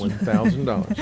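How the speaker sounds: high, rising disbelief at the price; mock-shocked echo of the ticket price; incredulous echo. Real, not acted. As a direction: repeat it back as if you can't believe it. Echoing a big ticket price.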